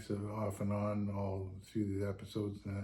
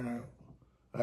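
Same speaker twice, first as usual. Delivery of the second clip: ordinary talk, nothing marked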